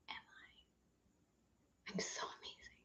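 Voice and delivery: slow whispered self-praise. Shown as a demonstration. Coach showing the class self-praise, whispered slowly. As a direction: breathe the praise in a hush. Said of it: "invite you to acknowledge yourself".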